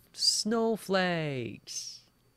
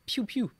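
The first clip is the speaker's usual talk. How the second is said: high-pitched "pew, pew"; imitated gunshots; 8 semitones higher than usual. Shown as a demonstration. Teacher illustrates the word gun, mimicked shots. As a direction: fire two playful toy-gun shots.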